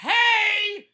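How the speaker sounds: long, high, loud scream; screaming for attention. Retold or imitated. Rider screaming at attendant who missed the lock. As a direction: stretch it into a desperate yell. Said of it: "And I have to scream".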